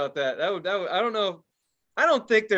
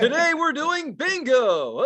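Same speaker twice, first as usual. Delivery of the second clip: game show host; 10 semitones higher, 4 dB louder, slower than usual. Acted. Host voice continues.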